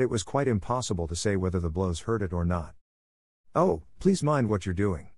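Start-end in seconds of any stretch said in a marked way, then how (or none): none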